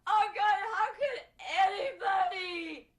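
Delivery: loud wail building to a tearful shout; hysterical sobbing. Acted. Convicted woman protesting no forensic evidence, wailing hysterically. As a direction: cry it out over and over, drawn out. Character wails over wrongful conviction.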